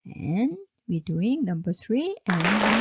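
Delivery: ordinary delivery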